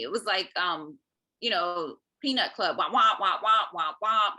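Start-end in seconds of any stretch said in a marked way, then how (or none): 2.8-4.3 droning wah-wah noise like muffled grown-ups; mock wah wah drone. Mother mocking the jargon at the school meeting, loud wah-wah drone. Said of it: "It was like mumbo jumbo to me".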